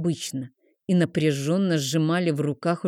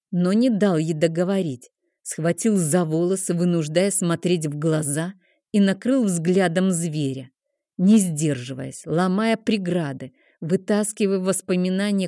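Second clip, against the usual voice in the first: ordinary delivery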